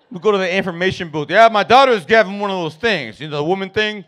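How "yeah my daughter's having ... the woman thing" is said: loud, embarrassed dad; flustered dad voice. Retold or imitated. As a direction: stammer through the awkward request.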